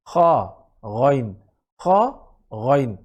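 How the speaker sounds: খা and গইন rasped where throat meets tongue; back-throat letters. Shown as a demonstration. Teacher drills খা and গইন from the upper throat. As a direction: scrape খা and গইন from the top of the throat. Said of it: "গলা যেখানে শেষ, জিহ্বা যেখানে শুরু".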